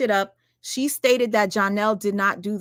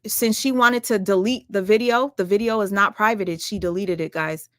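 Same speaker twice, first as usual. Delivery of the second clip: usual speaking voice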